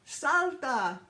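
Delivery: loud, high shout of "Salta"; shouted command. Retold or imitated. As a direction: call out over the water. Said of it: "La mujer en la lancha gritó".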